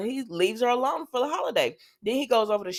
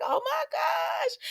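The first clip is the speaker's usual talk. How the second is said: high, slow, shrieking oh my gosh; gasped oh my gosh; much slower than usual. Retold or imitated. Tracy seeing Danny walk up, shrieking in surprise. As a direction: stretch it out in a squealing shock. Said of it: "And she's like".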